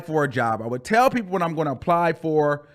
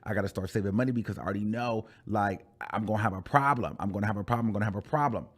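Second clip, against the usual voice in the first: quiet worry; 7 semitones lower, 7 dB quieter, faster than usual. Retold or imitated. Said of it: "because I'm like".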